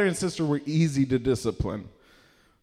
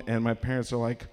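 ordinary talk, nothing marked

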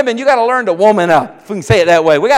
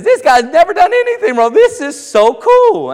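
much higher and louder, last line slowed; gleeful granddad; 9 semitones higher, 5 dB louder than usual. Retold or imitated. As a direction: crow with delight over it. Granddad delighted at jail.

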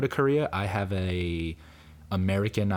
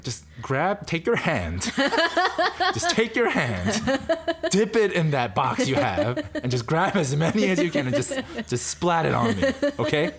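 rising, laughing plea to splat the ketchup; hammed-up frustrated customer, laughing; mock demand; 10 semitones higher than usual. Joking about stingy Korean ketchup, mock exasperated plea.

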